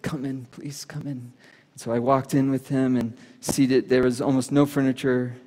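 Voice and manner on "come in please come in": soft, slow broken invitation; quiet tearful plea. Retold or imitated. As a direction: let the words come out soft and shaken, as if weeping. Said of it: "And he said".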